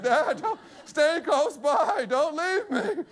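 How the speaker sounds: high, slow comic plea; boy calling out. Retold or imitated. Six-year-old riding off to Dad, high drawn-out plea. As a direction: imitate a child calling back, stretched out. Younger son calls from the basket.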